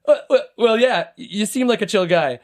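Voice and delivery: high, stumbling agreeable imitation; disarmed stammer. Disarmed driver conceding to the parker, genuine stammer. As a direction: act caught off guard and won over. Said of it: "I just, like, unarmed him".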